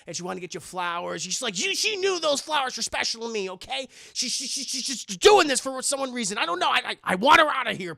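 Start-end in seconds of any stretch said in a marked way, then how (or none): 1.6-7.9 high, loud, upset rush of words; frantic wife rant. Retold or imitated. Wife panicking to husband about the adopted girl, high and agitated.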